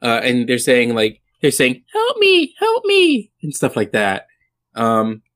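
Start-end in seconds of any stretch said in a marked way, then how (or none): 1.9-3.3 mock damsel's cry, pitched way up; cries for help. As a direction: play women calling for help in a thin high falsetto. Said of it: "they are screaming out".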